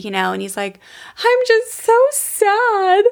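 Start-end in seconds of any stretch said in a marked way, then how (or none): 1.2-3.0 put-on high, slow little-boy plea; crying little boy. Boy calling his dad back from bed, high slow whimper. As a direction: whimper it up high like a sad small child. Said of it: "And so he's crying".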